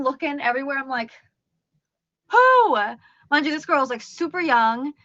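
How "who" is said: high, loud, startled cry; spooked babysitter yelling into an empty room; startled shout. Retold or imitated. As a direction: call out sharply, spooked. Frightened narrator searching the room.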